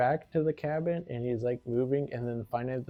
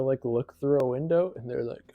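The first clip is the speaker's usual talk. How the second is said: usual speaking voice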